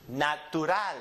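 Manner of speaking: natural in Spanish, na-tu-RAL; Spanish end stress. Shown as a demonstration. Teacher demonstrates words ending in L, natural stressed on RAL. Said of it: "the last syllable gets emphasized".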